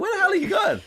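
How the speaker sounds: high, loud mock outrage; exasperated yell. Teammate spots him running off, exasperated. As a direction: screech after someone who has wandered off. Retells her yelling after him.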